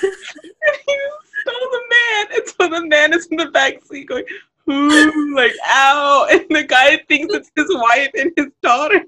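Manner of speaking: high, squeaky words through laughing fits; helpless laughter. Real, not acted. Host picturing the groaning man in the back seat, cracking up. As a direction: barely hold it together while talking. Cracking up about stolen patient.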